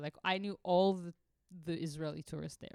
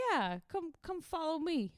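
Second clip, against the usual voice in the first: higher, eager local guy inviting along; 9 semitones higher than usual. Retold or imitated. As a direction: play the helpful local, a bit higher. Local guy voiced in story.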